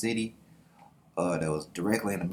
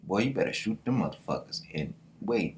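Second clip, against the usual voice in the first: normal talking voice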